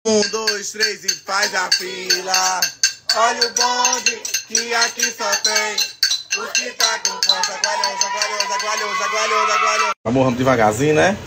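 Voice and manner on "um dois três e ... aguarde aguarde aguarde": high, slow sing-song announcer; playful queue announcer. Pretend. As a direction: drill the hungry kids into line. Serving queue of hungry kids. (anunciador brincalhão, agudo e lento)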